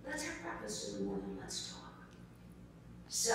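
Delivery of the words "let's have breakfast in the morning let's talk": very soft, quick imitated invitation; retold stranger's hushed coaxing; gentle persuasion. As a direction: drop the voice and invite kindly.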